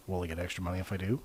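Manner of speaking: low, very soft, quick aside; muted deadpan. Retold or imitated. Injured worker asking manager about extra money, muted deadpan. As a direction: slip it in quietly and fast. Deadpan reply to concussion question.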